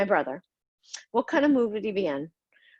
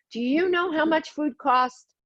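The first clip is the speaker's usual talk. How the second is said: higher, slow, furious demand; raging brother; 6 semitones higher than usual. Retold or imitated. Brother yelling at siblings over wasted food, enraged. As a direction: bite out each word like an enraged brother.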